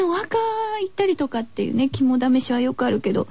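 normal talking voice

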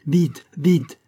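high, loud, hurried command; urgent exclamation. A narrator's reading. Lafayette sends men to Le Bourget, crying out urgently. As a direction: cry out to rush them into action. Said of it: "s'écria Lafayette".

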